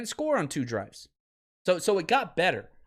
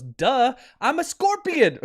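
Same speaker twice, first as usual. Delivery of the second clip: high, loud scorpion punchline; hammed-up duh, as if it's obvious; mock obviousness; 6 semitones higher, 5 dB louder, slower than usual.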